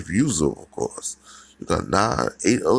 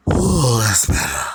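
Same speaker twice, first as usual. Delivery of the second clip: relieved sigh; 4 dB louder than usual. Acted. Relief after the blast.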